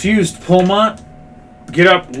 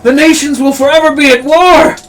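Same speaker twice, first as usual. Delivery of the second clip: high, loud dramatic outburst; furious warning shout; 11 semitones higher, 6 dB louder than usual. Acted. Cornered man warns his attacker of endless war, furious shout. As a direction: berate them at full voice. Acted villain berates attacker.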